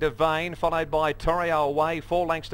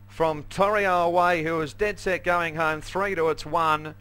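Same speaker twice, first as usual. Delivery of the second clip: usual speaking voice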